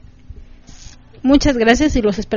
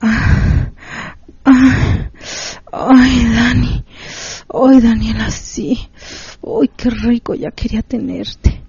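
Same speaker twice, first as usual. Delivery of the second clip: breathy louder moans and sighs; moaning farewell; 4 dB louder, slower than usual. Acted. Cohost giving Daniel her farewell, moaning and aroused. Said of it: "va tu despedida".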